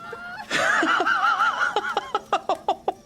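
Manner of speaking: long, high burst of laughing; hearty group laughter. Acted. Family at Christmas dinner, roaring with laughter. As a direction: everyone roaring with mirth. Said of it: "revelled into another laugh".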